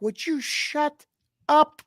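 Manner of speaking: high, slow, exasperated wife voice; annoyed wife. Retold or imitated. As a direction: play the embarrassed wife telling him off. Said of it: "And she's like".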